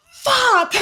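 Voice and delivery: high, loud burst of cursing; retold dismayed shout. Guides watching the campers run off, exasperated shout. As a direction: shout it out as a re-enacted panic.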